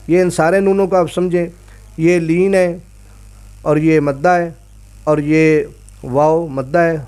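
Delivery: usual speaking voice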